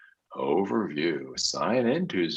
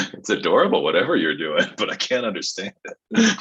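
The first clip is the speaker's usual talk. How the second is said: ordinary talk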